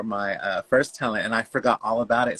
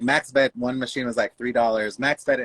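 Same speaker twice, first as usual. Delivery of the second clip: ordinary talk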